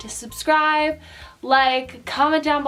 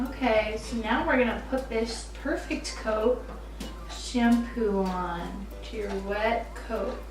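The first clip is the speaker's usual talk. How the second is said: usual speaking voice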